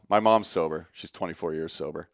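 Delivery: ordinary talk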